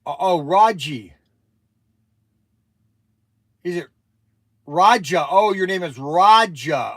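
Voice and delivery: loud surprised correction on the phone; surprised realization. Real, not acted. On a call, realizing the caller's name, loud surprise.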